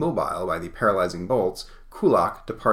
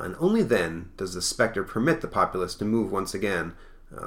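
plain reading voice

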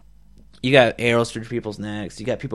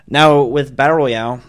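ordinary delivery

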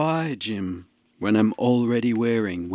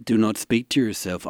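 usual speaking voice

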